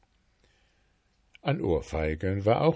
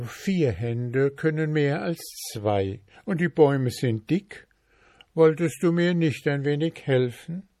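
higher, wheedling request; coaxing journeyman; 6 semitones higher, 3 dB louder than usual. Acted. Journeyman coaxing the dwarf to help, higher wheedling. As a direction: sweetly ask for a hand.

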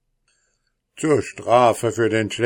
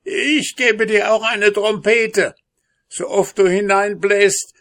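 high, loud dwarf voice, pleading bargain; high-pitched wheedling dwarf; howling dwarf; 9 semitones higher, 5 dB louder than usual. A narrator's reading. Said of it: "heulte der Zwerg".

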